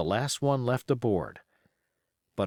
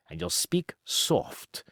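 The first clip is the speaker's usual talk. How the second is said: whispered; 6 semitones higher, slower than usual. Acted. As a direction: drop to a whisper on the order. Silver orders Hands to speak soft.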